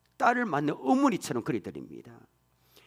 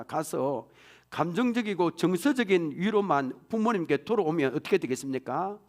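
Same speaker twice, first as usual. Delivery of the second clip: usual speaking voice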